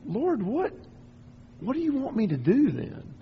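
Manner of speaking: high, exasperated cry to the Lord; frustrated plea. Retold or imitated. Praying alone, frustrated with God: high exasperated plea. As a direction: throw up your hands at God, pitched high. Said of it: "and I'm frustrated".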